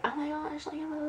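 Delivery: high, hushed mock freak-out; squeaky-quiet mimicry of panicked babble; mock fuss. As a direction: imitate someone fretting in a small high voice. Mimicking someone making a fuss.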